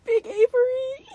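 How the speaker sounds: very high, loud squeal; high-pitched delighted cry; excited squeal. Real, not acted. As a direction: squeal it in delight at the big reveal. Sees the big plush revealed.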